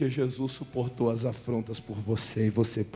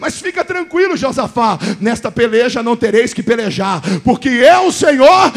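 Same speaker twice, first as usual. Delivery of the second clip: shouted higher and higher to a crying roar; God's voice thundering; 10 semitones higher, 18 dB louder, slower than usual. Acted. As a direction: boom out God's promise to the king. Eu lembro de Josafá.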